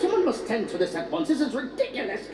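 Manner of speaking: indignant, very proper mock English; proper British accent. Englishman fussing over trash, mock British accent. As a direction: complain in a hammed-up British accent, pitch climbing. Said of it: "The accent is so proper".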